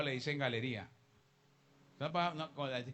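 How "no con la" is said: usual speaking voice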